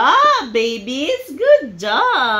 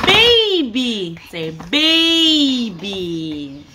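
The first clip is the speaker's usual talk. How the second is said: normal talking voice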